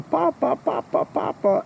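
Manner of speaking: high, light imitation of yelling children. A narrator's reading. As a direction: imitate excited little ones calling for their father.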